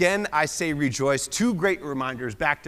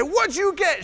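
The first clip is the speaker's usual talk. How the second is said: excited; 14 semitones higher, 3 dB louder than usual. Retold or imitated. Answering Ellie's excited shout.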